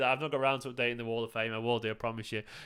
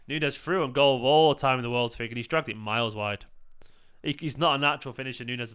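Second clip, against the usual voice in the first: ordinary talk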